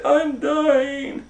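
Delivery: very high dramatic whine; Baby Bear whining. A narrator's reading. Baby Bear burned by porridge, very high whine. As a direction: wail it like a tiny bear. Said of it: "And Baby Bear said".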